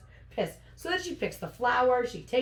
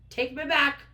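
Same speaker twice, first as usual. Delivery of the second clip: louder, higher acted order; indignant, raised-voice command; firm demand; 5 semitones higher, 5 dB louder than usual. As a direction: demand it forcefully. Heroine demands to leave.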